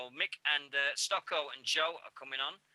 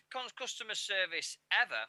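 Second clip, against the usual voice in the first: normal talking voice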